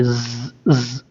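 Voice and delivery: ज़ buzzed and held; letter practice. Shown as a demonstration. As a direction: drill ज़ slowly. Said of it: "इस तरह से थोड़ी सी प्रैक्टिस कीजिए". (ज़ को खींचकर)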